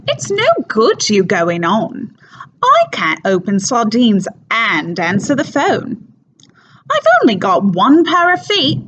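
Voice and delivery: overdone, silly stage Cockney with big swooping pitch; Mrs Clackett, thick Cockney. Acted. Housekeeper on the phone explaining everyone's in Spain, silly thick Cockney. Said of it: "Cockney accent, but, um, on stage it's very, very thick, overdone, and kind of silly".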